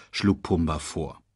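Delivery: plain reading voice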